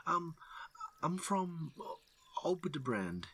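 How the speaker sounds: high, hesitant mumble; nervous mutter. Acted. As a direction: stranger answering the rangers, scared. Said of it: "the man mutters".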